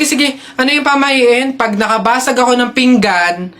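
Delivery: normal talking voice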